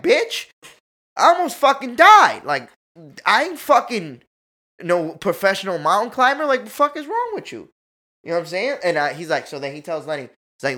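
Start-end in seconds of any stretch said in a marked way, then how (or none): 0.0-7.7 higher and louder, retold furious rant; angry outburst. Yelling at the tour guide who scolded him, furious. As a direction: let him have it, indignant and loud.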